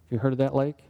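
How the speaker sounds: normal talking voice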